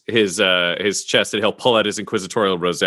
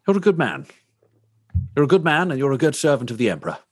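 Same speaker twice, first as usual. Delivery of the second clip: lightly acted officer handing out praise, pitch lifted at the start; approving officer; 6 semitones higher, faster than usual. Officer praising a reporter as a servant of the Emperor, lightly acted approval. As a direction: praise him like an Imperial officer.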